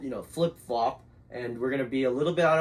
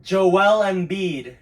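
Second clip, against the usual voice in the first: loud, slow call over headphone music; loud guess; 5 semitones higher, 9 dB louder, much slower than usual. Real, not acted. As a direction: shout it because you can't hear yourself. Guessing with earbuds in.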